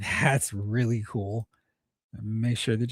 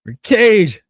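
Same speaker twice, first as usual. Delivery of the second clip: loud, high shout-out; calling out a name; 9 semitones higher, 10 dB louder than usual. Real, not acted. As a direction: holler the name to a viewer.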